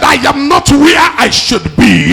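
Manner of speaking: high, loud preaching roar as Paul's inner cry; shouted preaching cry. Acted. Preacher voicing Paul's restless spirit to the church, shouting. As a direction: bellow it out, full force, burning with frustration. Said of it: "Somebody said".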